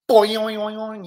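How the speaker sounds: springy, repeating boing; boing effect. Acted. Hero hitting the spaceship, cartoon boing. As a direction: bounce the sound out like a spring.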